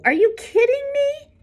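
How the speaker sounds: high, loud mocking disbelief; are you kidding me, snotty and shrill; scoffing. A narrator's reading. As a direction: sneer it up high like it's absurd. Shay scoffing at Albert.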